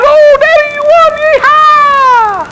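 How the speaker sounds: put-on very high, loud girlish squeal, drawn out; hammed-up squeal ending in a long yeehaw; excited daughter. Daughter greeting her victorious dad, hammed-up excited shriek. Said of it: "And she's excited".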